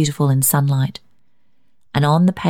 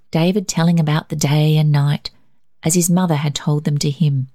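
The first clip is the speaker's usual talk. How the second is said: ordinary delivery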